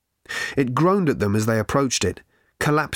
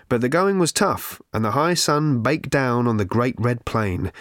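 usual speaking voice

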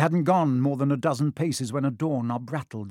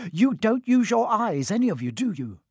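high-pitched, bad-tempered scolding; snapping; 9 semitones higher than usual. A narrator's reading. Hermione scolds the others after the escape, snapping. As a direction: rebuke them all impatiently. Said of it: "she snapped".

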